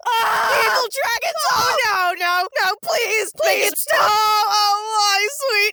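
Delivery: high-pitched wailing panic; hammed-up crying and shouting in horror; mock terror. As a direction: cry out in exaggerated dread. Hammed-up panic at the scary scene.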